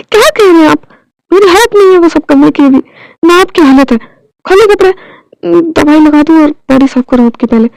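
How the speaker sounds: scolding him to take his clothes off for medicine; indignant. Acted. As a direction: snap back, then command. Indignant reply and firm order.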